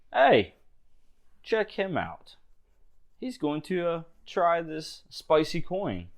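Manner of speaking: high, jeering guard voice; mocking guard. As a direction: tease the newcomer to your mates.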